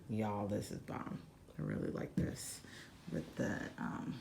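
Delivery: soft unvoiced aside; whisper. Real, not acted. Vlogger confiding to viewers about the room, whispered. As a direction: whisper it like a secret to the camera.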